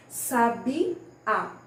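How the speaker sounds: "Sabiá" broken into drawn-out syllables; stressed-syllable demo. Shown as a demonstration. Teacher shows pupils the tonic syllable, syllable-by-syllable stress. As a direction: say "Sabiá" syllable by syllable, leaning on the strong one. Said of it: "a sílaba tônica da palavra".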